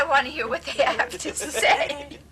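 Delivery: slow, amused, wistful wish; laughing plea. Retold or imitated. Young devotee pleading to join the group, laughing. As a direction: laugh through the longing.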